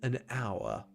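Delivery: slow, drawn-out time-skip announcement; mock narrator. Player narrating the time skip, mock solemn. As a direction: stretch it out as if time crawls.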